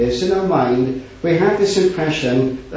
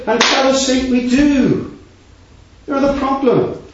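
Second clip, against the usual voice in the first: higher, louder rising preaching; fervent preaching; 6 semitones higher, 4 dB louder than usual. Acted. Preacher acting a believer declaring faith, fervent and rising. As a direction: proclaim it with building conviction.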